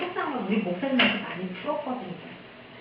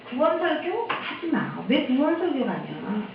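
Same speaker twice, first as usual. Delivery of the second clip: mock complaint; 6 semitones higher than usual. Church members telling the pastor what not to preach, protesting. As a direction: imitate objectors, pitch raised and words stretched.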